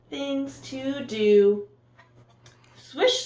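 ordinary delivery

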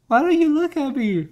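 high mock girlish question; girl's voice. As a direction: pitch up as the girl asking. Girl's line voiced in retelling.

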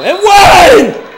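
And one shouted high and loud; celebratory shout. Acted. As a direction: yell it out like you just scored.

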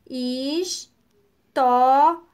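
"Historinha" slow, in four separated syllables. Shown as a demonstration. Teacher helps pupils count syllables, saying each one separately. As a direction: sound out "Historinha" piece by piece, with long gaps. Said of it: "vamos separar as palavras em sílabas".

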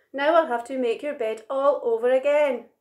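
exasperated. A narrator's reading. Big Monkey must make the bed again, exasperated. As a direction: tell off with exasperation.

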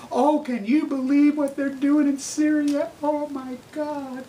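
high, slow, drawn-out mock concern; mock news presenter. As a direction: parody a host's airy dismay, stretching the words. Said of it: "I talk like this".

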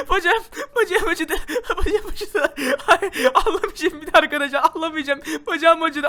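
slow, high whine through nervous laughter; pained whimper. Pretend. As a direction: whimper in pain, half laughing, half crying. Hurt leg, whimpering and laughing. (gülerek acıyla sızlanma)

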